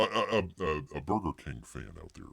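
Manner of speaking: ordinary talk, nothing marked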